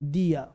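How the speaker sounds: "दिया" ending in a vowel, no r; correct DIYA. Shown as a demonstration. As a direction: say "दिया" with the r dropped. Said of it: "इसका sound है सर". (सही उच्चारण "दिया", र के बिना)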